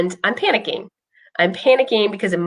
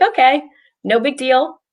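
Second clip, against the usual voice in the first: higher-pitched, casual "no problem" tone; unfazed, higher-pitched quote of herself; breezy retold reaction; 6 semitones higher than usual. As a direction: toss it off lightly, pitched up. Retells her first calm reaction.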